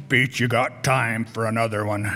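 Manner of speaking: low put-on caller's voice; little guy's voice. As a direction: imitate a little man's deep phone greeting. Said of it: "it sounded like this all the time".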